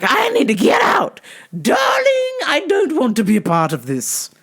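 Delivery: high shrieking mom voice. Retold or imitated. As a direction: squeal it like a panicked mother. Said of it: "My mom's like".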